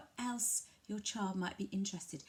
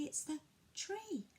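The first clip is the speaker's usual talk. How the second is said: soft naming; 8 semitones higher, 6 dB quieter, slower than usual. Shown as a demonstration. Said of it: "you could say".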